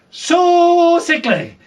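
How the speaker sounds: high, slow stretched words; drawn-out sickly. Acted. Describing Margaery's illness, high drawn-out mock pity. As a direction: stretch it out theatrically.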